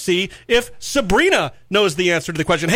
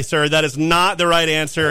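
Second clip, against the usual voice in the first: ordinary talk, nothing marked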